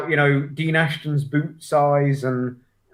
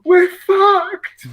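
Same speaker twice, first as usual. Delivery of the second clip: mock despair; 5 dB louder than usual. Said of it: "and then it's me just going".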